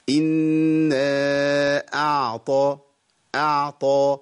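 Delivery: slow chanted إنا أعطى, clean ع before heavy ط; measured recitation, crisp أعطى; ayn separated from ta. Shown as a demonstration. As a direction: hold the ع of أعطى, then release ط. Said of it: "وبخلص العين من الطاء".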